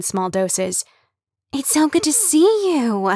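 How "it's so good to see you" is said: much higher, bubbly greeting; excitable gushing. Acted. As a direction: gush over her like big news. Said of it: "always sounded breathless".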